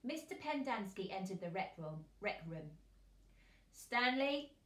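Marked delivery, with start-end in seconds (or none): 3.7-4.6 higher, louder call of a name; raised, hailing voice. A narrator's reading. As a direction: call across the room to get attention. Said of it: "he called as he made his way".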